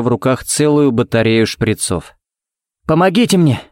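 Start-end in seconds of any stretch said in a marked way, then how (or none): 2.9-3.6 high urgent cry for help; shouted for help. A narrator's reading. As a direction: call out for help in a hurry. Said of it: "крикнул он". (громкий крик о помощи)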